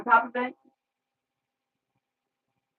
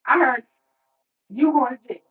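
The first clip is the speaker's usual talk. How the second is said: teasing loud announcement; 3 semitones higher, 8 dB louder than usual. Retold or imitated. Gossiping loudly about jail.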